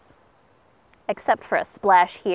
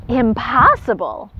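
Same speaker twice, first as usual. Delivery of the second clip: louder, slow, indignant cry; indignant declaration; 3 semitones higher, 7 dB louder, slower than usual. Acted. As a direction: protest loudly. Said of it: "declared the spoonbill".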